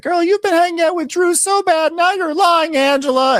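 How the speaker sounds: very high, loud falsetto; sassy high accusation; mock Kelly voice. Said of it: "Kelly is like".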